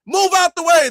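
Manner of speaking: loud high shouted command; yelling at ice to move; mock yell. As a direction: bark it at the officer. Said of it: "We gonna be yelling at ice".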